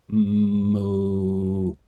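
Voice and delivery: sung mock moo; cow moo. Streamer showing off cow sound to chat. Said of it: "I can do a pretty good cow".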